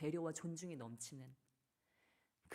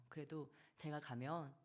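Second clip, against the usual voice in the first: usual speaking voice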